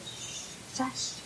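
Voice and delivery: sharp whispered shush to quiet a sister; hushing whisper. A narrator's reading. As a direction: hush someone fast so nobody hears. Hushing a sibling in bed.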